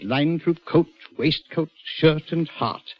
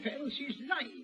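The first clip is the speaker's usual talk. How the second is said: hushed aside; 12 semitones higher, 14 dB quieter, slower than usual. Acted. Governor whispering to the doctor, hushed. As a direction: keep it under your breath.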